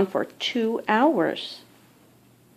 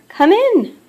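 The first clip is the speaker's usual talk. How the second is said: Bunny Queen welcoming; 11 semitones higher, 6 dB louder than usual. Acted.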